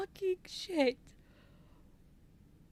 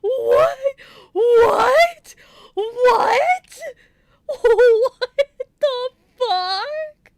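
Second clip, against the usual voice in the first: real screams of disbelief, much higher and louder; shocked yelling; 8 semitones higher, 14 dB louder, much slower than usual. Real, not acted. Player shrieking in shock as the game character hears him.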